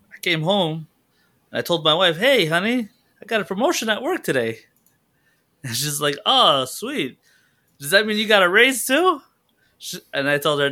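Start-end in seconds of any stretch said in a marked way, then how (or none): 2.2-4.6 higher-pitched retold greeting to a wife; announcing good news. Acts himself greeting his wife. 6.2-9.2 lightly acted, higher-pitched wife; voiced wife. Voices wife's reply in joke.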